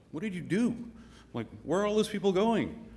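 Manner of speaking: high-pitched acted disbelief; incredulous demand. Saint Peter grabs the fleeing promoter, demanding in high-pitched disbelief. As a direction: demand answers, pitch jumping well up.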